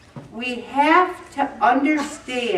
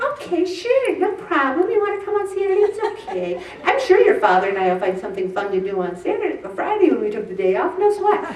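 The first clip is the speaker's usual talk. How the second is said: high, sugary fake-calm agreeable voice; Stepford wife voice; 7 semitones higher, faster than usual. Mother to son Vin, high forced-cheerful Stepford wife voice. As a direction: sing it out much higher, forced cheerful calm. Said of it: "I always talk in a Stepford wife voice".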